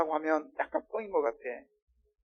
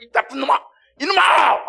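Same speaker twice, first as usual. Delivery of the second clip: loud, high mock scolding; angry scolding; 9 semitones higher, 10 dB louder than usual. Preacher imagines Jesus scolding Peter, angry shout. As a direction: bawl him out as if furious. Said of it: "똑같이 화를 냈다면요".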